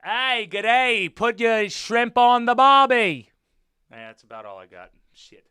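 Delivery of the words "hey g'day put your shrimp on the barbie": loud, hammed-up Australian accent. Shown as a demonstration. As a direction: belt out a cartoon Aussie greeting. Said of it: "I can do Australia".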